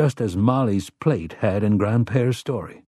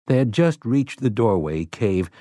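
usual speaking voice

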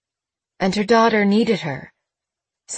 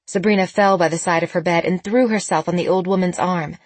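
ordinary delivery, nothing marked

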